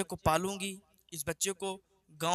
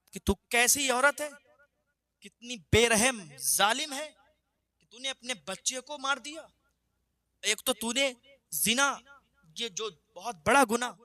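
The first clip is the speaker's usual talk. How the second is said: higher, louder furious rebuke; stern prophet scolding a sinful woman, furious; prophet's wrath; 5 semitones higher, 3 dB louder than usual. Acted. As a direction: thunder at her for the sin she confessed. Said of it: "غضب و جلال میں آ گئے".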